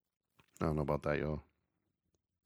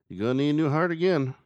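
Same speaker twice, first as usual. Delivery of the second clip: mock announcement; 10 semitones higher, 7 dB louder, faster than usual. Mock line to a forgetful patient.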